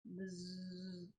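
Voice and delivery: low, soft buzzing noise; quiet imitated buzz; buzzing hum. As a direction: hum it like a vibrating motor. Vibrating sound effect.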